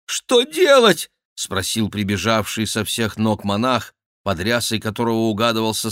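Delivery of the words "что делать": slow, higher, louder panicked cry; more frantic repeat. A narrator's reading. The monk repeating his question more frantically. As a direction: push the panic further.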